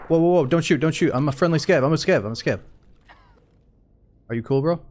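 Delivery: loud high pleading don't shoot; panicked surrender shout. Real, not acted. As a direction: yell fast to stop them shooting. Calling out to another player.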